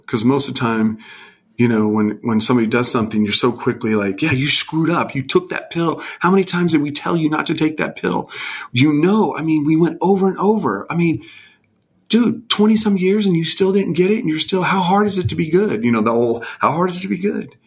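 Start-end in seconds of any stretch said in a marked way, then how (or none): 4.2-15.8 higher, exasperated parental lecture; mock scolding. An impatient parent scolding a child who relapsed, mock exasperation. 16.6-17.5 higher, exasperated parental lecture; mock scolding. An impatient parent scolding a child who relapsed, mock exasperation.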